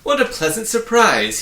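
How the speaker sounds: thrilled to have visitors at last; happy loud greeting to his visitors; happy cry. A narrator's reading. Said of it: "he cried happily".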